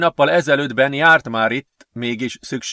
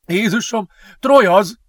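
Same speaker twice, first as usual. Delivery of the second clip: high-pitched alarm building to a shout; panicked, high and growing louder; alarmed exclamation; 10 semitones higher, 6 dB louder than usual. A narrator's reading. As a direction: cry out on spotting the pursuer. Boy sees the pursuer coming.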